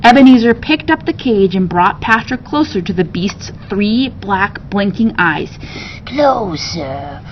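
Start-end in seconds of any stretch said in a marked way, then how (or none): 6.0-7.2 low, slow, soft command; soft slithery order. Acted. Beast ordering the cage closer, soft and slithery. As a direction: beckon him in, silky and hungry. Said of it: "ordered the beast".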